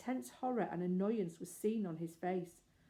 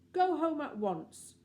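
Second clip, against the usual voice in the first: loud, slow stern order; terrible voice; 8 semitones higher, 7 dB louder, slower than usual. Acted. The grown-up lamb sternly ordering Anthea home. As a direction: lay down the law slowly. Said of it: "in a terrible voice".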